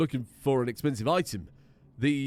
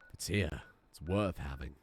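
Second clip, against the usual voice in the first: low, soft, mock-seductive murmur; suggestive lip-licking; 10 semitones lower, 6 dB quieter than usual. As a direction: drop it low and quiet, like a lewd innuendo. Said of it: "winks and slowly licks his lips".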